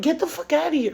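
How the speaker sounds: high-pitched, dismissive mock denial; lying sister's denial. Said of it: "with her Gemini lying ass".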